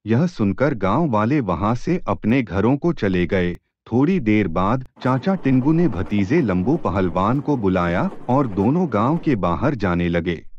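plain reading voice

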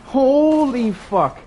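drawn-out high holy fuck; slow, high, amazed holy fuck; stunned exclamation. Real, not acted. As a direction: stretch it out in awe. Amazed at a shot.

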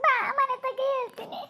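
high, tearful voice on the phone; acted sobbing, words squeezed high; crying. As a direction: sob out a request to come over. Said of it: "ಯಾಕ್ ಹಂಗ್ ಅಳ್ತಾ ಇದ್ದಿ".

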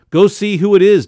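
loud high call. A narrator's reading. Father calling his son to the door. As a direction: call to the boy.

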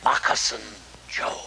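hushed whisper of a warning; old guide whispering to the hunters, hushed whisper. Retold or imitated. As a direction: breathe it out like the animal might hear. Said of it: "And then he whispered".

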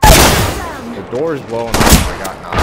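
loud urgent firefight callouts; cover me shout. Real, not acted. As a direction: yell it mid-fight. Firefight, getting knocked, yelling.

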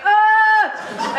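high, loud retching noise; imitated dry heave. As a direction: mimic a friend gagging. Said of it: "Alicia starts dry heaving".

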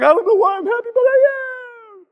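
high, slow dopey camel voice; puzzled delight, drawn out; happy camel. Retold or imitated. Voicing a camel in the photo, high and slow. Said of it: "It's like".

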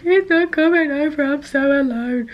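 mock self-pity. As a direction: whine it like a sulking child, half joking. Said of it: "I'm just like".

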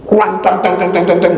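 rapid high clanging engine noise; spoken clanking sound effect; imitated motorbike racket. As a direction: rattle off a clattering motorbike sound. Imitates noisy old motorbike.

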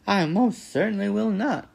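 high, loud, affronted refusal; indignant goose, pitched high; squawking goose. A narrator's reading. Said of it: "squawked the goose".

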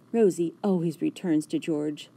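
ordinary delivery, nothing marked

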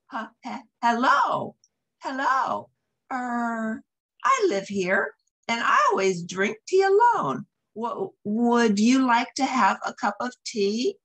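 a bashful bear stumbling over his words; shy nervous bear. Acted. Shy bear invites mouse.

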